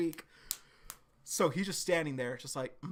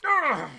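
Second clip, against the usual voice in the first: loud, high mock grunt of pain; villain hit by a blade, grunting; 10 semitones higher, 8 dB louder than usual. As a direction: act out being slashed. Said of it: "And Kree's".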